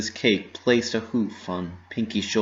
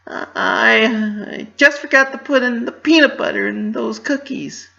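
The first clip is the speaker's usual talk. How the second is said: forced smile; 14 semitones higher, 7 dB louder than usual. Acted. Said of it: "forcing up a smile".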